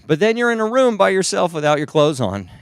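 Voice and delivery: ordinary delivery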